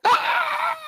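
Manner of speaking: sudden high yelp of fright; startled scream. Retold or imitated. Boy at the wall, screaming when the ball vanishes, high panicked yelp. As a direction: shriek like you just saw the impossible.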